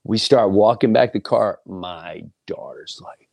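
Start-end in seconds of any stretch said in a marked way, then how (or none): 1.7-3.3 hushed unvoiced aside; whisper. Real, not acted. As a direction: drop to a whisper.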